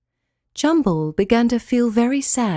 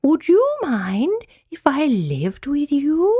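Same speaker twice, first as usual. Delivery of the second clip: very slow, swooping spider voice; delicate spider; 4 semitones higher, much slower than usual. A narrator's reading. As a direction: draw out every word in long glides. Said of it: "asked the delicate and hairy spider".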